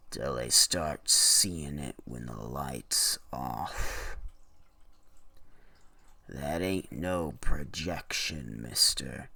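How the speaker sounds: put-on low, slow, ominous old storekeeper; ominous shopkeeper. Shopkeeper warns the buyer about the light, low and ominous. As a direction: lean in low like you know a dark secret. Shopkeeper voiced in read story.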